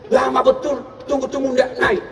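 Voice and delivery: ordinary talk, nothing marked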